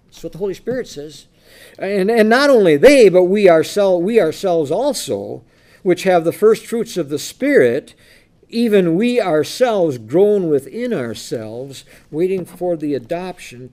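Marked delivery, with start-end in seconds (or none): 1.8-13.7 loud, slow, emphatic Bible reading; preacher reading aloud deliberately; scripture reading. Acted. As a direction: proclaim the verse, weighting each phrase.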